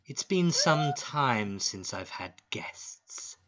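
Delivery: slow, drawn-out monster hostess; loud, lingering villainess greeting; leering spider lady. Acted. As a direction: savour each word as you size up fresh guests.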